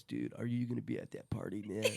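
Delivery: a hint of a laid-back stoner. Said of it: "I do have a good impression of it".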